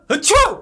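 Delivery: high loud fake sneeze; big staged achoo. Fake sneeze to set up joke.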